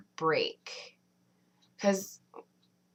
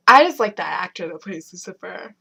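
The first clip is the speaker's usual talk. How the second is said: yawning; 10 dB louder than usual. Real, not acted. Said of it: "Why do I keep yawning?".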